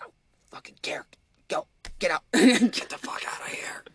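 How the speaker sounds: raspy voiceless whisper, pitch up near the end; whisper after throat surgery. Retold or imitated. Brother just out of throat surgery, voiceless whisper. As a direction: hiss it with no voice, like a healing throat. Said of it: "so he can't talk".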